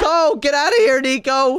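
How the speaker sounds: high, loud shout to flee; urgent shout. Acted. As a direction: yell at him to run. Shouting friend away from danger.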